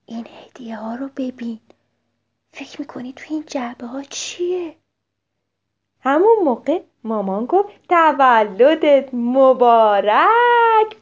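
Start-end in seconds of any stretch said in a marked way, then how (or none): 0.1-4.8 whispered into a friend's ear; soft secret whisper. Acted. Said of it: "در گوش میمیکی گفت". 7.9-11.0 drawn-out, loud birthday greeting; slow joyful birthday wish; happy birthday cheer. Acted. Mom wishes happy birthday.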